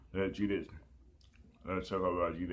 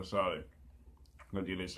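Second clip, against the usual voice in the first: ordinary talk, nothing marked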